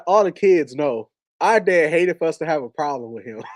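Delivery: normal talking voice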